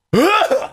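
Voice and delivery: high, explosive choking outburst; exaggerated strangled sneezing sound; imitated sneeze noise. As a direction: mimic a man's monstrous sneeze. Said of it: "then he starts going".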